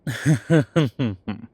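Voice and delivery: big rolling demonic laugh; loud devilish laugh. Acted. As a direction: throw back a loud wicked laugh. Demon laughs at own joke.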